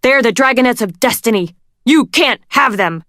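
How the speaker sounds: fast, loud defiant shout; desperate shout. A narrator's reading. Dune shouting at queen.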